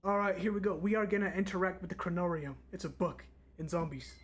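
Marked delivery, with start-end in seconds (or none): none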